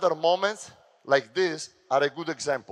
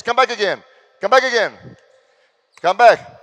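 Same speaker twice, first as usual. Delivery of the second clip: loud, higher calls; yelling instructions at players; coach shouting; 4 semitones higher, 9 dB louder than usual. Real, not acted. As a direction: urge them back hard. Coach directs drill.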